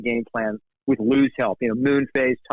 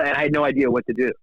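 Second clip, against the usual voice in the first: usual speaking voice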